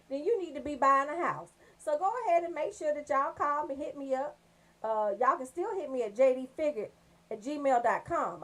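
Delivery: ordinary delivery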